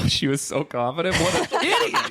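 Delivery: talking through giggles; high laughing teasing. Real, not acted. Laughing at the wrong guess.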